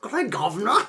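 high-pitched British greeting; cartoonish British "governor"; mock British accent. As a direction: put on a hammed-up British accent. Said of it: "my British accent sucks".

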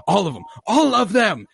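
high, loud panicked yelp; hammed-up frightened officer. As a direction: squeal it in mock panic. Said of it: "they're like".

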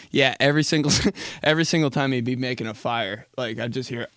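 ordinary talk, nothing marked

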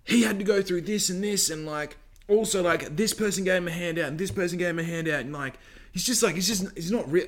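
lightly voiced know-it-all friend; mocking her rant. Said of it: "she goes".

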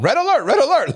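body blaring a high red-alert warning; alarm voice. Retold or imitated. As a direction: sound the body's alarm like a siren. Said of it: "now the body is going".

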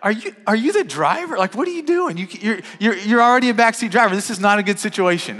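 playfully scolding the backseat driver; incredulous dad. Retold or imitated. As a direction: tease the kid like a dad who can't believe it. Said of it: "And I'm like".

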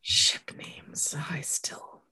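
soft whispered aside; hushed conspiratorial whisper. Real, not acted. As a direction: whisper it like a secret.

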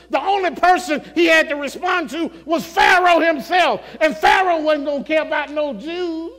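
loud, high, rising preacher's cry; preaching at full force. Acted. Preacher thundering to the congregation about Joseph's power.

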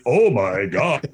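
slow, drawn-out put-on broadcaster; mock polished exclaim; affected announcer exclamation. Shown as a demonstration. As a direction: fake radio-voice surprise. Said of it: "your reaction wouldn't be".

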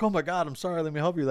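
lightly acted fretting; mock alarmed apology. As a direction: play at panicked concern, voice lifted. Acting alarmed Clark apologising in film.

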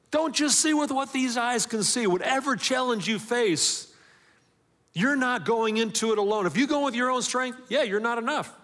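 rising sermon passion; fiery preaching. Acted. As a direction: preach it out full force to the room. Preacher builds to full force.

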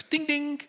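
high-pitched imitated text alert; mimicked message notification, high and light; phone chime. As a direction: sing out a little phone ding. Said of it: "my phone goes".

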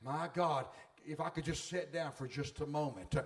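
a touch of a tired worshipper; worn-out flesh. Acted. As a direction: beg to sit down like an aching body. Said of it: "your flesh is going".

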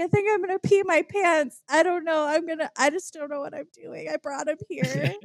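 high, overwhelmed babble, imitating happy tears; mock-weepy and giddy, pitch staying high; crying with excitement. Woman retelling her meltdown to mom, imitated tearful excitement. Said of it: "And I'm crying and I'm like".

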